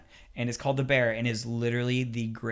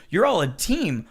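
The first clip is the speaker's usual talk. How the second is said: ordinary talk, nothing marked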